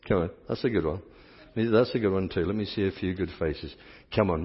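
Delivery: ordinary delivery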